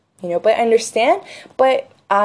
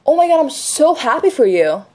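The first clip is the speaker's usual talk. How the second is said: excited for a friend; 6 semitones higher than usual. Retold or imitated. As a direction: cheer her on, thrilled. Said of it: "I was like".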